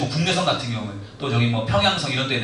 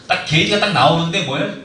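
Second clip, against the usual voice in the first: usual speaking voice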